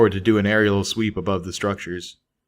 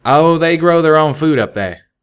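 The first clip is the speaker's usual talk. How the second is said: higher, louder, slow deadpan; higher, louder and slow, mock-casual pegasus; nonchalant; 7 semitones higher, 8 dB louder than usual. A narrator's reading. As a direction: toss it off like it's obvious. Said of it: "Calamity answered nonchalantly".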